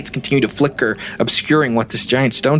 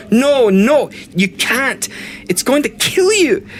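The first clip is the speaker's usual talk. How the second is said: frantic shouting; 12 semitones higher, slower than usual. Acted. A girl fights to stop the console shutting down, shouting in a panic. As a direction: scream at him to stop, terrified for him. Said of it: "she starts just kinda shouting".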